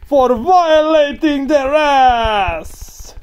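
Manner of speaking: very high, loud, slow screech; over-the-top victory scream; excited screech. Real, not acted. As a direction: shriek it out, stretched long. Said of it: "I got a little excited".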